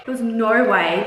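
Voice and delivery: ordinary talk, nothing marked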